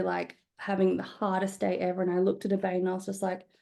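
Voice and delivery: ordinary delivery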